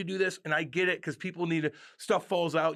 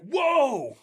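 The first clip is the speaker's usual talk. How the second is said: high, loud startled yelp of whoa; sudden loud whoa of alarm on a tight ride; 12 semitones higher, 8 dB louder than usual. Retold or imitated. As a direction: blurt it out like the ride just jolted you. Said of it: "I'm like".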